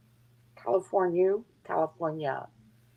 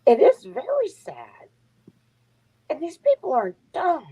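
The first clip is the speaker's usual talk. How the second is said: emphatic; 10 semitones higher, 5 dB louder than usual. Real, not acted. Emphatic sympathy for people.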